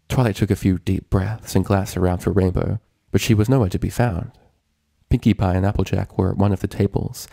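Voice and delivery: plain reading voice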